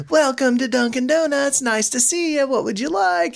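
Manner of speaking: high, sing-song polite greeting; sweet cashier. Retold or imitated. Joking about Knoxville staff, high sweet cashier. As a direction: lay on the customer-service sweetness. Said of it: "they're like".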